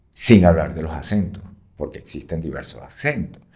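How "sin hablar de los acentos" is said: ordinary talk, nothing marked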